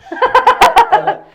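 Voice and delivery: high, loud laugh; burst of laughter. Real, not acted. Player laughing over the slime, loud high laugh. Delighted reaction to playing with slime.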